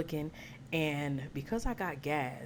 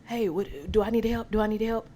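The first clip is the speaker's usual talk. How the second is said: loud, higher-pitched mock yell; imitated yelling; 7 semitones higher, 6 dB louder than usual. Driver behind calling to the attendant, imitated yelling. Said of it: "starts yelling at the attendant".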